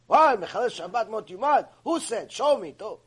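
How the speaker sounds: loud, high, indignant challenge; hammed-up angry objection; mock Israeli heckler. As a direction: bark back like a heckler objecting mid-lecture.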